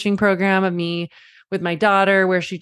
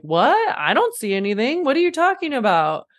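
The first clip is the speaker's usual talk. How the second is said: higher, innocent mock confusion; clown playing dumb; 6 semitones higher than usual. Fair clown to the audience, hammed-up innocence. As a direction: pretend you see nothing at all. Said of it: "playing dumb".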